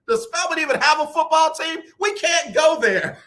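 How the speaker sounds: half-laughing mock shout about Spelman; mock temper tantrum. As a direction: throw a playful on-air tantrum. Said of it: "Yes, I'm having a temper tantrum".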